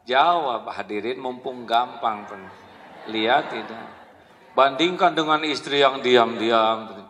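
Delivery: usual speaking voice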